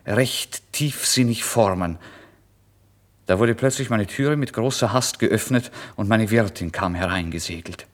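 ordinary talk

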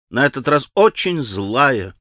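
ordinary delivery, nothing marked

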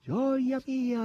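high, excited squawk of delight; raucous cry. A narrator's reading. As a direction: call it out high in noisy joy. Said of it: "ridestando con i suoi schiamazzi". (schiamazzo gioioso e acuto)

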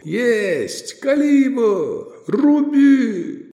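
high, loud rising shouts; yelling from the sidelines. A narrator's reading. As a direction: bellow it like a crowd. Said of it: "взревели трибуны".

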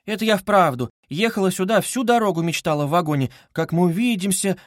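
high, eager, embarrassed mother's voice; lightly voiced anxious mother; flustered mother. A narrator's reading. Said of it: "заговорила в смущении Пульхерия Александровна".

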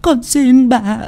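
put-on high, whimpering girl on the verge of sobs; tearful pleading. A narrator's reading. Frightened granddaughter begging her delirious grandmother, tearfully pleading. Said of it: "rồi mếu máo".

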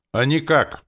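loud, high barked refusal. A narrator's reading. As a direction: bark it right back at him. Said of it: "гаркнула она".

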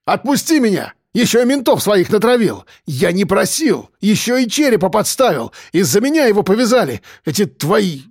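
outraged complaint in rapid bursts; indignant. A narrator's reading. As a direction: demand to be let go, aggrieved. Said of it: "возмутился Андрей".

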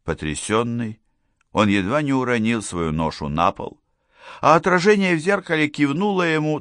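ordinary delivery, nothing marked

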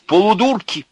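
high, loud exasperated abuse; ranting disbelief, pitched high; indignant tirade. A narrator's reading. Bound Князев abuses the crowd.

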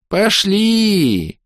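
высоко и тягуче; протяни слово лениво; тягуче протянуто. A narrator's reading. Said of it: "тягуче протянул". (эльф тянет слово, высоко)

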